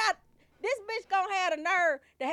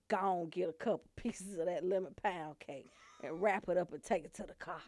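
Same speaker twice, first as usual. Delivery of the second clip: softer, secretive instructions; mock hushed order; 8 semitones lower, 9 dB quieter than usual. Mother quietly sends her kids to steal cake, hushed. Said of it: "get her kids to be like this".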